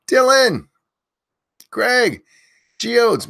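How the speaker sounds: loud, high name call-outs; yelling names. Real, not acted. Host yelling chat names to early viewers, loud and high. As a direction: belt each viewer's name out. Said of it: "if you want me to yell your name".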